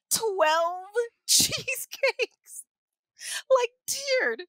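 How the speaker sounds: high, slow, laughing words. Real, not acted. Telling a friend about the cheesecakes, laughing high.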